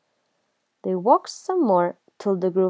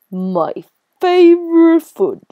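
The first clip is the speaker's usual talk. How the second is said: relishing monster; 9 semitones higher, 9 dB louder than usual. Acted. Gruffalo delighted to find prey.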